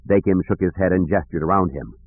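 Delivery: usual speaking voice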